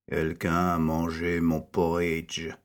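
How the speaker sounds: a lightly deepened bear dad; Papa Bear. A narrator's reading. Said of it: "s'exclama Papa Ours". (voix grave de Papa Ours, légère)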